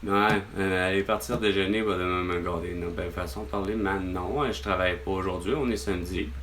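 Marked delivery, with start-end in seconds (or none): none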